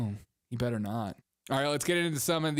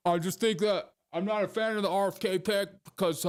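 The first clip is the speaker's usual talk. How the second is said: mock politician; 6 semitones higher than usual. Imagined Chris Christie quote.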